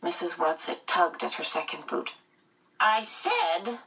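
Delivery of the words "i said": put-on gruff strained old woman's voice; grunting old woman. A narrator's reading. Old woman pulling on her boot, grunting. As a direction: grunt it out as she tugs the boot. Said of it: "she grunted".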